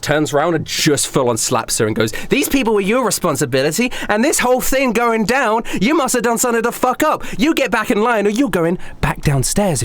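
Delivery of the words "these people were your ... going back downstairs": high, hurried tirade; pitched-up telling-off, fast and heated; angry scolding. Acted. Club boss blames waitress for the trouble, scolding angrily.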